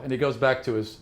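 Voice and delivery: normal talking voice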